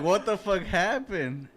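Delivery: stunned disbelief; surprised. Retold or imitated. Retold shock at the car.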